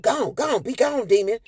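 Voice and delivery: mock exorcism. Husband banishing wife as a demon, hammed-up. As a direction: cast her out like a preacher. Dad casts mom off.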